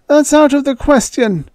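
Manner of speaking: put-on high, loud old voice refusing; firm old man refusal. A narrator's reading. Ewing refusing Joey in a high old voice.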